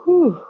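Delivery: high, loud exhaled whew of relief; relieved whew. Retold or imitated. Mom retelling her son's relief over his grades, loud high whew. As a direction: let the air out like a weight just lifted. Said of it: "He's like".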